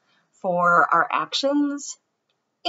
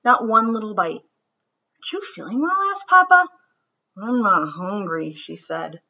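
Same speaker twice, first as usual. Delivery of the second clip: gentle storybook read-aloud; children's story narration; 2 semitones higher than usual. A narrator's reading. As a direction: read it like bedtime story time. Reads picture book aloud.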